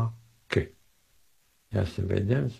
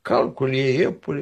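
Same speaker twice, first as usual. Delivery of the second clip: wry inner remark; 8 semitones higher than usual. Retold or imitated. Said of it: "Dar am zis".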